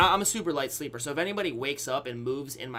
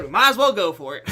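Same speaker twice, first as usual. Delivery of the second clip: words breaking into a laugh, louder; 6 semitones higher, 9 dB louder, faster than usual. Real, not acted. Telling viewers his choice, laughing through the line. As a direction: laugh as you admit the punchline.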